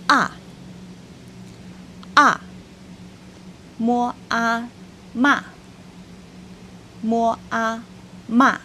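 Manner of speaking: "a" and "ma" start high and drop sharply; Mandarin fourth tone. Shown as a demonstration. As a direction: snap "ma" down from the top. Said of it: "Quatrième ton, c'est le ton descendant".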